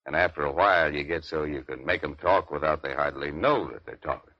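normal talking voice

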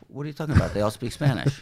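retold incredulity. Retold baffled reply to friend.

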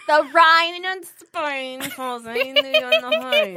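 Australian accent through teeth. Shown as a demonstration. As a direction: copy a bad Australian accent with teeth closed. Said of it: "sound like you're talking through your teeth".

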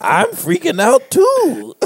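high, slow words dissolving into laughter; laughing, high and slow. Retold or imitated. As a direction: deliver it laughing at the absurdity. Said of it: "And Trevor Noah says".

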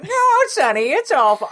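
very high put-on old lady voice; squeaky hammed-up granny impression; Willem Dafoe as old lady. Co-host imagines the Goblin using an old lady voice, high put-on granny. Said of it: "I want Willem Dafoe being like".

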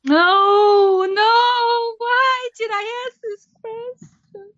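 hammed-up crying in regret; mock wail. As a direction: draw out a mock-despairing sob. Dreads hearing about eating bugs.

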